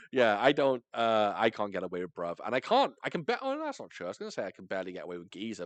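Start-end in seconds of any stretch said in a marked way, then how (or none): none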